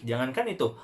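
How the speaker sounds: usual speaking voice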